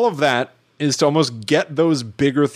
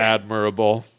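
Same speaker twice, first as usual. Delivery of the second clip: mock echo; 4 semitones lower than usual. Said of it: "I find it admirable".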